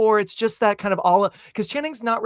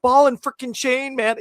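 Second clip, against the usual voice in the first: higher imitated film-character voice; a little Doctor Evil; 7 semitones higher, 4 dB louder than usual. Host quoting the film, with a touch of Doctor Evil. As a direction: put on the character's odd accent, slightly villainous. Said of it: "a little bit of Doctor Evil".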